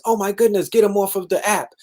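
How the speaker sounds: high, fussy mock panic; hammed-up scandalized officials demanding action; mock panicked officials. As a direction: lift the voice and ham up the alarm. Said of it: "The reaction is".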